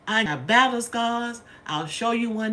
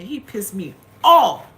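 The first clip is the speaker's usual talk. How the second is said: slow, much louder angry declaration; angry outburst; 12 dB louder than usual. Real, not acted. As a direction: let the anger burst out on every word. Angry at the nurse.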